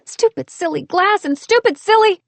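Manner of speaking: high, clipped-off frustrated swearing; frustrated cursing. A narrator's reading. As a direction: curse at the glass in a huff. Cursing after cutting her hand.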